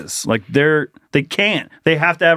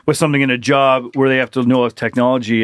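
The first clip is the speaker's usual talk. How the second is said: ordinary talk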